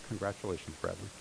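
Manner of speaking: ordinary delivery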